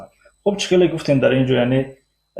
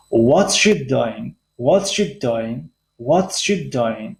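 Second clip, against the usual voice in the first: "What's" high, then down on "doing", very slow; high start, low ending; much slower than usual. Shown as a demonstration. Teacher models a WH question for learners, high at the start.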